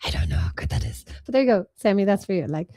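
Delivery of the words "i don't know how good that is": hoarse whispered Batman-style voice; requested character voice. Pretend. Host redoes a voice on request, raspy whisper. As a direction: rasp it out in a whisper. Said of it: "do that voice again".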